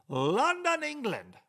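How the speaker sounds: a hammed-up British accent on London; a quick high mock British accent; mock English accent. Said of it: "might have been Australian accent there".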